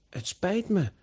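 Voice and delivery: high-pitched, hasty sorry; quick apology. A narrator's reading. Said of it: "zegt Giacomo snel".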